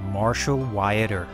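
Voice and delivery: normal talking voice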